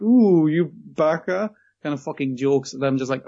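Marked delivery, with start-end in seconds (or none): 0.0-1.5 slow, louder, hammed-up anime flirt; sing-song mocking anime voice, slow and louder; mock anime tease. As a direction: drag it out like a cutesy anime joke. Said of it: "it's like".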